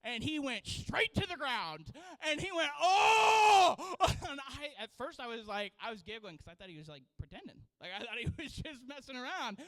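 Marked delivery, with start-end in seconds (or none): 2.8-3.9 loud high cry of pain; a yelp of real pain. Retold or imitated. Pastor struck by the whip, loud cry of pain.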